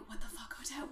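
hushed frantic whisper; panicked whisper. Retold or imitated. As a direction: hiss it at her in a panic. Courtiers panicking in retelling.

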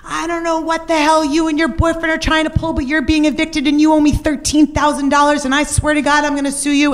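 high, shrill-pitched yelling landlord; mock landlord scream. Landlord yelling down the phone at his tenant, high mock scream. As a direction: squeal it like the furious landlord on the phone. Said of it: "and he's screaming".

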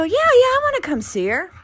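very high, loud eager yes. Retold or imitated. Accepting the visit, very high and eager. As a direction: jump at the offer, high and loud. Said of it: "But I go".

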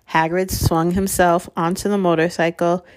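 ordinary delivery

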